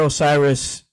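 normal talking voice

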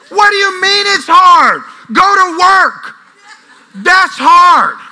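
loud, high, drawn-out dad rant; exasperated scolding. Retold or imitated. Dad answering sons who say it's hard, exasperated shouting. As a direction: holler it slowly at lazy kids.

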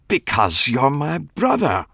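slow, high, insistent; emphatic. A narrator's reading. Clive insisting to the narrator, slow and emphatic. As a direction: lean on every word. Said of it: "he said emphatically".

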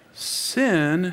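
ordinary talk

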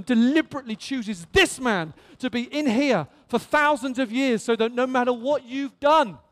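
ordinary delivery

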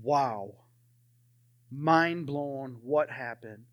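low, softened, stunned wonder; hushed amazement. Retold or imitated. Retelling when God spoke to him, quietly stunned. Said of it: "and just be like".

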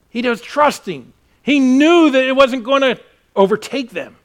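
loud, high emphatic declaration; full-force preaching. Acted. Pastor insisting Jesus trusted, loud preaching. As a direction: proclaim it to the room.

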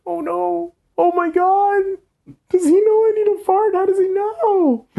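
very high, wailing panic; mock-wail in mounting horror; acted panic. Storyteller replaying their embarrassed inner panic, high wailing.

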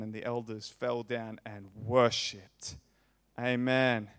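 ordinary talk, nothing marked

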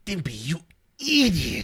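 slow, high, angry cartoon outburst; exasperated cartoon scolding; cartoon Ren impression. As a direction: drag out the insult, loud and high.

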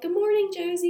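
high, bright imitation of a sweet four-year-old; sweet little sister. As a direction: chirp it like a sweet big sister greeting the baby. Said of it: "she's being so sweet".

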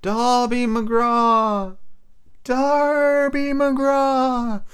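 high, very slow ghostly wail repeated; eerie wailing call. A narrator's reading. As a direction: stretch each name into a haunting cry. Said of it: "Again and again and again".

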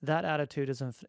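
ordinary talk

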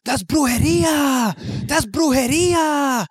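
high, slow frantic mock shout; yelling brujeria. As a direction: cry witchcraft in panic. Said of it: "you just hear him yelling".

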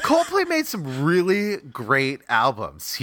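drawn-out praise, holding back laughter; mock-earnest praise. Joker plays a Coldplay fan, mock-earnest. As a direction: stretch it out slowly like a mock fan.

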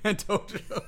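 "And Tojo" repeated through a laugh; laughing echo. Real, not acted. Echoes laughing.

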